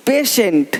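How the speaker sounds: पेशेंट with sh in the middle, falling; correct pronunciation. Shown as a demonstration. Teacher modelling patient with sh, correct pronunciation. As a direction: say पेशेंट as "PE-shent". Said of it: "पेटेंट नहीं".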